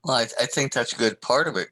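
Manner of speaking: normal talking voice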